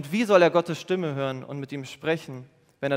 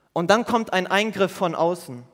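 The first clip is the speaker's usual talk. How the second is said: usual speaking voice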